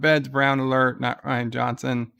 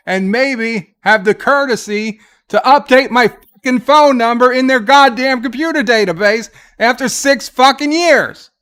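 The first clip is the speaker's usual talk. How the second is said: firm complaint rising to a furious shout; real exasperated outburst, louder and higher, shouting by the end; building angry rant; 4 semitones higher, 6 dB louder than usual. Real, not acted. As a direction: let the frustration boil over into yelling. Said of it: "Are you okay?".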